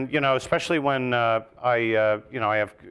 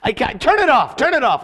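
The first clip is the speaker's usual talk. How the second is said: mock panicked plea; 12 semitones higher, 8 dB louder than usual. Cringing at old recording.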